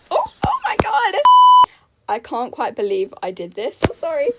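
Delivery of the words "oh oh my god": high, loud shriek; shocked exclamation. Real, not acted. Startled outburst during gameplay.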